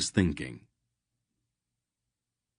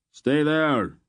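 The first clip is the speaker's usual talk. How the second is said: higher, loud yelled answer; yelling; 8 semitones higher, 4 dB louder than usual. A narrator's reading. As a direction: yell it back across the pier. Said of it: "Greldick yelled back".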